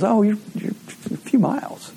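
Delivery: a hint of a gentle grandma; sweet old lady.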